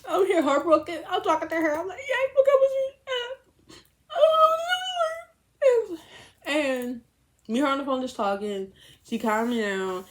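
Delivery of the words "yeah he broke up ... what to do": high, loud sobbing plea; crying. Retold or imitated. Heartbroken on the phone with her friend, high loud crying. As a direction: wail it through tears.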